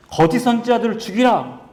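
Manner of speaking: high, forceful proclamation; crying out. Acted. Elijah after winning on Mount Carmel, crying out a command. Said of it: "이렇게 외칩니다".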